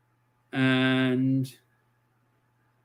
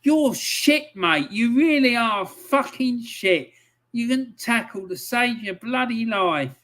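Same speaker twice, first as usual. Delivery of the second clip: angry rant; 6 semitones higher than usual. Real, not acted.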